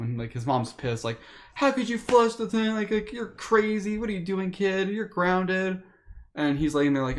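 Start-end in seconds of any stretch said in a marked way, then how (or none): 1.5-5.8 higher, scolding mom voice; angry mom. Retold or imitated. Mom scolding her son for flushing the pets. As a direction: scold like a fed-up mother.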